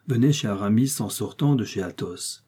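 ordinary delivery, nothing marked

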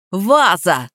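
ordinary delivery

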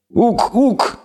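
high bleating goat-man hoot; goat creature hooting. Acted. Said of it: "гукнул чудик".

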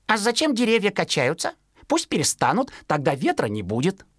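stubborn fledgling. Acted. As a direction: argue like a know-it-all chick. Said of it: "Он и сказал".

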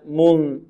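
من held long, humming in the nose; nasal hidden N. Shown as a demonstration. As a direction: glide from the m of من into a held nasal hum. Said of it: "مع غنة في الخيشوم".

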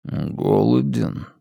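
slow, soft, otherworldly voice; a stranger's voice inside her; alien inner voice. A narrator's reading. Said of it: "подумала она голосом, который не принадлежал ей".

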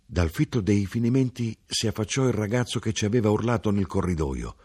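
usual speaking voice

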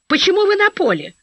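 high-pitched, appalled question; horrified. A narrator's reading. Passing woman in flight uniform challenging a stranger, horrified. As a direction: ask it aghast, pitched well up. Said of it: "ужаснулась мне".

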